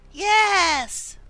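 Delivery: high loud eager yes. Acted. Player agreeing to the mission, eager burst. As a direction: blurt yes with excitement.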